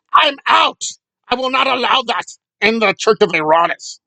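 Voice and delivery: hammed-up outraged commenter; mock indignation. Voicing an audience comment against race baiting, mock indignant. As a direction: imitate a commenter storming off in protest.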